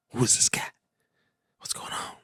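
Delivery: hushed, breathy whisper to himself. Real, not acted. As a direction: whisper it so the show isn't disturbed. Reacting to a show on screen.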